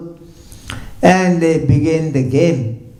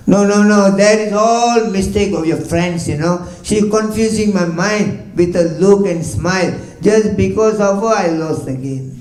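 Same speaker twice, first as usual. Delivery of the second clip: higher, louder, blaming her friend; upset protest; 6 semitones higher, 3 dB louder than usual. Acted. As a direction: protest hotly that it's not your fault. Krishna protesting after losing game.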